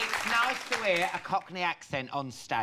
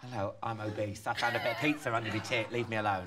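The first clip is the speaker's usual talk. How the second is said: mock obese singer; 8 semitones lower than usual. Voices an obese opera singer.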